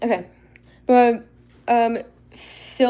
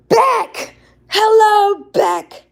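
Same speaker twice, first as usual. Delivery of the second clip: louder, higher happy greeting shout; excited call; 12 semitones higher, 9 dB louder, much slower than usual. Real, not acted. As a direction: call out to a friend at the door. Greeting friend arriving.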